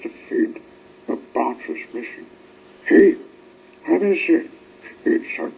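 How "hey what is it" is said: excited call. A narrator's reading. Excited call in harbour story.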